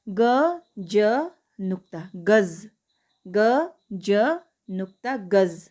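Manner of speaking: slow, careful teacher spelling of each sound. Shown as a demonstration. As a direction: spell it out slowly, letter by letter, ending on gaz. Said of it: "मेरा उच्चारण सुनिए".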